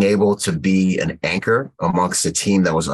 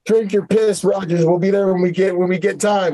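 higher mocking voice of the driver brushing him off; mock gruff reply; 11 semitones higher than usual. Driver brushing off thirsty Marines, a mocking gruff reply. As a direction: throw the reply back as a careless jab. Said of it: "He's like".

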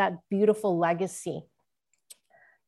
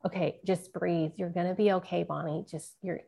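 quietly calming a crying friend; soothing friend; 2 semitones lower, 5 dB quieter than usual. Retold or imitated. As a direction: gently talk someone down, trailing softer. Friend soothing her on the phone.

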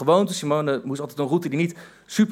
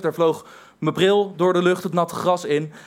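ordinary delivery

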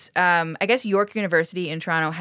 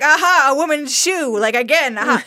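loud gloating aha, pitched high; triumphant; 7 semitones higher, 6 dB louder than usual. Retold or imitated. Gare gloating loudly over the woman's shoe, high and triumphant. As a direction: crow over the shoe like a winner. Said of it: "Gare's like".

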